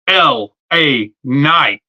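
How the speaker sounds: each of the three words stretched and set apart; imitated slow ring-entrance catchphrase; drawn-out entrance call. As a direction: announce it very slowly, word by word.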